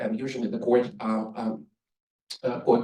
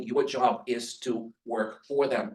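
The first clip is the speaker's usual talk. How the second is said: ordinary talk, nothing marked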